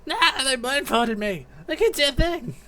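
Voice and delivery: high-pitched distressed captive voice; blindfolded captive. Acted. Blindfolded captive who can't see a thing, high distressed voice.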